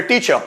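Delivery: टीचर said "tee-chuh", no R; silent final R. Shown as a demonstration. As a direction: say टीचर with the last R dropped. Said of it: "उसको silent बना दिया जाता है".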